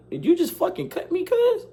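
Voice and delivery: shocked he actually got cut; stunned disbelief. Retold or imitated. Classmate just cut his hand.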